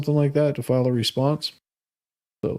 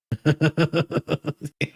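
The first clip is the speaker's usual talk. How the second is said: amused laugh. Real, not acted. Reacting to a chat comment.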